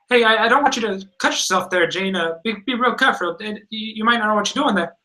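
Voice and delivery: high, nagging helper voice; Jimmy Hart. Retold or imitated. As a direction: imitate a fussing meddler. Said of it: "Jimmy comes in there, and he's like".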